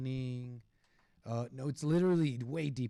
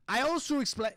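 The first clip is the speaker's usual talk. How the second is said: higher, louder failed accent attempt; botched British accent; 10 semitones higher, 5 dB louder than usual. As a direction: overdo a bad British accent. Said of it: "I can't do the accent".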